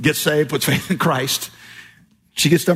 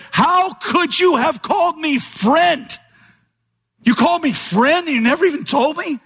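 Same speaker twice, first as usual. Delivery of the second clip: lost friend in eternity confronting you, anguished; anguished accusation; 10 semitones higher than usual. Pretend. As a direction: accuse a friend who stayed silent. Imagined soul accusing in eternity.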